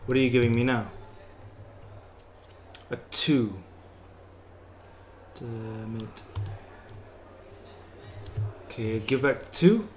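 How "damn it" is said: very soft, lower muttered curse. Acted. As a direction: curse quietly under your breath. Losing at a card game.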